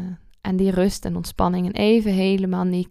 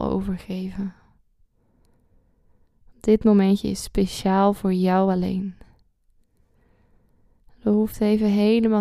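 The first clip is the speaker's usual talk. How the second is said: calm guide with long pauses; guided meditation. Acted.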